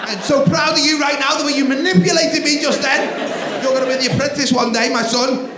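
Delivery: high, loud grand praise; mock proud. Dad addressing the son who tricked him, high loud mock pride.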